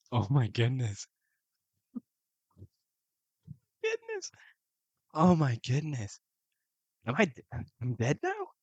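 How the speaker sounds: real disbelief at the ending; stunned outcry; shocked. Real, not acted. Learns the character died.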